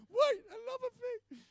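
high, loud, stretched wail; bawling yell. Retold or imitated. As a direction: cry it out, acting a frantic yell. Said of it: "I'm yelling at him".

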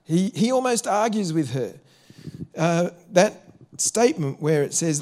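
ordinary delivery

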